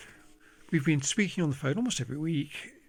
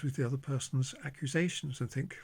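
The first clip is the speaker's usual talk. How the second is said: normal talking voice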